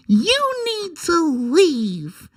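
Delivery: high, slow, drawn-out "You need to leave"; catchphrase clip voice. Retold or imitated. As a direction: stretch it out up high like the famous clip. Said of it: "my little sound clip".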